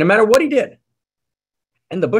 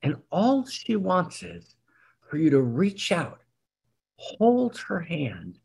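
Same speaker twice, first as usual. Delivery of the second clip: intimate hush; 2 semitones higher, slower than usual. Acted. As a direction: speak as if into one listener's ear. Intimate sermon about God's nearness.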